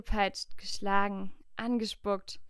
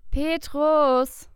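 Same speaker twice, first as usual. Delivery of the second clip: loud call, higher and louder; calling out a name; 6 semitones higher, 9 dB louder than usual. A narrator's reading. Maria shouts for Petrus at his door, loud call. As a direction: call him hard through the door.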